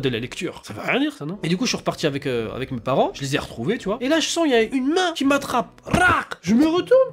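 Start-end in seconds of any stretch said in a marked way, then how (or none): 5.9-6.3 high, sudden "rac"; imitated grab noise. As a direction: snap out a grab sound effect. Imitates hand grabbing him.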